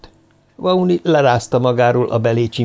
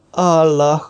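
drawn, higher-pitched moan; groaned exclamation; 5 semitones higher than usual. A narrator's reading. Professor waking face to face with rival, groaning. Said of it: "nyögte".

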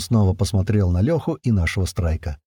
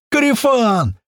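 high, drawn-out cry to a buddy; joyful yell; 13 semitones higher, 3 dB louder, slower than usual. A narrator's reading. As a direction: holler it high, overjoyed. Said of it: "орал Лёха".

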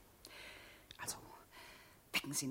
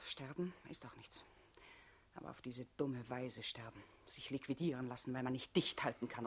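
softer, lower contemptuous murmur; low scorn; 6 semitones lower, 16 dB quieter than usual. Acted. Olga scorning Hugo's foolish death, softly. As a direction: let the contempt sink down.

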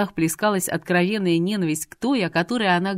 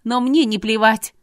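high, loud snap; barked retort; 4 semitones higher, 6 dB louder than usual. A narrator's reading. Irina snaps back that she does care, barking. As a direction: bark it back, pitched up. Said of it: "рявкнула Ирина".

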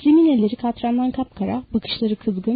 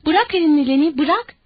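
high, urgent, mildly raised command; repeated shout; 5 semitones higher, 4 dB louder than usual. A narrator's reading. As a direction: call out urgently to make him stop. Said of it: "George tekrar tekrar bağırdı". (telaşlı, tiz sesle bağırış)

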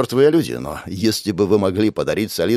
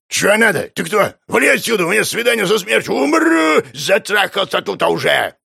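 put-on angry old man shouting; 11 semitones higher than usual. Old man snapping at hero.

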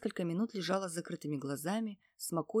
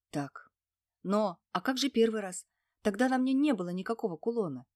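usual speaking voice

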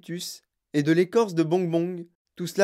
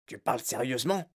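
soft, lower, startled little chief; startled Oompa Loompa chief; 5 semitones lower, 6 dB quieter than usual. A narrator's reading. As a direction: gasp the question, taken aback. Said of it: "demanda en sursautant".

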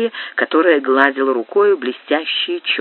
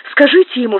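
cry out; 9 semitones higher, faster than usual. A narrator's reading. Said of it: "вскричала графиня".

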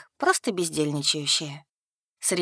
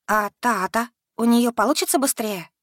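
higher, mildly anxious question; a touch of concern for someone else; worried question; 5 semitones higher, 3 dB louder than usual. A narrator's reading. Student asks about her classmate's progress, mildly worried. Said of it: "заволновалась Янка об однокурснице".

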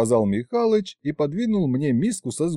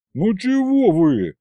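higher, drawn-out protest; sulky; 8 semitones higher, 4 dB louder, slower than usual. A narrator's reading. Son pleading with the doubters, sulky. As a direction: whine a little in complaint. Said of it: "насупился я".

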